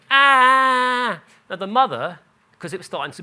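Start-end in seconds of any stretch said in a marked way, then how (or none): 0.1-1.2 loud high mock wail of a hurt toddler. Toddler who fell outside McDonald's, imitated loud wail. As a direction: let out a toddler's pained cry, hammed-up. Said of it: "started crying, started going".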